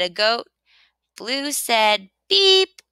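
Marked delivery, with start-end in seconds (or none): 2.3-2.8 high, loud little horn beep; truck's beep. A narrator's reading. Little Blue beeps hello to the goat with a high beep. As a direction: toot it bright like a toy horn.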